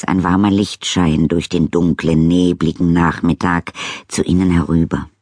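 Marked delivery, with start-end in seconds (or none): none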